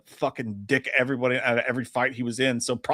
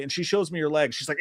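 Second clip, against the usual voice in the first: usual speaking voice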